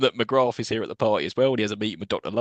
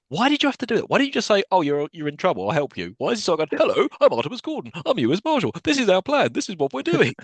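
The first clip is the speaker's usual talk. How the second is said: mock over-eager explainer; 9 semitones higher than usual. Mocks Gordon over-explaining.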